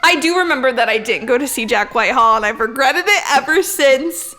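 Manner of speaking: ordinary delivery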